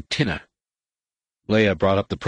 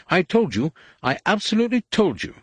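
much higher, fast and shrill-edged panic; droid crying out; 12 semitones higher than usual. A narrator's reading. Droid crying out as the mass detector bleeps, in higher, fast alarm. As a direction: squeal it like a vindicated, panicking droid. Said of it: "C three P O cried".

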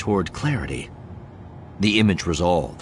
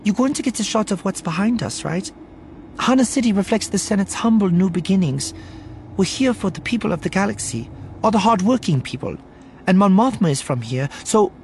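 high-pitched, anxious PR representative; earnest, eager spokeswoman voice; nervous publicist figure; 12 semitones higher than usual. Acted. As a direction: press the talking points in a high voice.

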